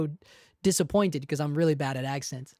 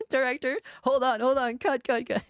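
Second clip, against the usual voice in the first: lightly voiced director, higher pitched; retold director interrupting; 7 semitones higher than usual. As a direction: halt the take mid-performance, mildly urgent. Retold director halting recording.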